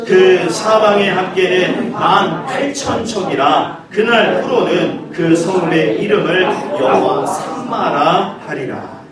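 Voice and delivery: slow measured scripture reading. Acted. 목사가 교인들과 함께 성경을 천천히 낭독. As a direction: read the verse slowly with the church.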